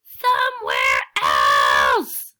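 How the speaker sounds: low, loud, slow bellow; exasperated shout. Acted. Elephant to Piggie about the birds, a slow, loud, low bellow. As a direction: roar it out, fed up.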